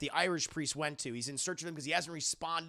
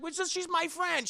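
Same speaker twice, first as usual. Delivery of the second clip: high defensive squeak; 11 semitones higher than usual. Retold or imitated. Priest protesting to his sister in a high squeak. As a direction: pitch way up, protesting weakly. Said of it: "It's like".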